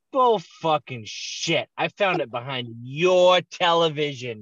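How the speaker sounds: slow, emphatic mock scolding; mom's angry accusation. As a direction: imitate a mother laying out the evidence, drawn out. Mother's accusation acted out.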